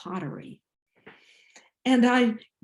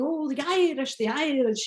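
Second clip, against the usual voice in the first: Irish brogue comedian; 5 semitones higher than usual. Retold or imitated. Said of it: "roared all these jokes in this Irish brogue".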